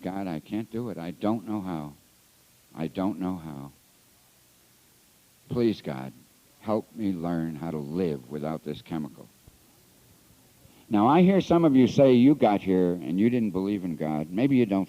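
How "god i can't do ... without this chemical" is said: soft, low pleading prayer; quiet prayer. Retold or imitated. Recovering alcoholic on his bed, softly praying to God. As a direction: beg God quietly, almost to yourself.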